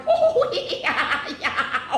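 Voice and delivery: long, high hooting cackle; whooping laughter. A narrator's reading. Bismarck completely losing the dare, whooping laughter.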